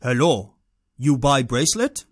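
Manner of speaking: badly imitated foreign accent of a street seller; imitated hawker with a thick foreign accent; horrible foreign accent. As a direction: put on a rough foreign accent, selling. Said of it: "excuse my horrible accent".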